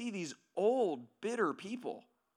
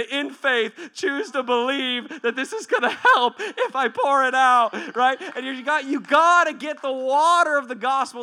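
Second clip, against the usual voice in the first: loud, raised, urgent exhortation; full-force preaching; 5 semitones higher, 10 dB louder than usual. Real, not acted. Preacher urging people to pour it out, full-force exhortation. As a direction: shout it out with conviction, stretching key words.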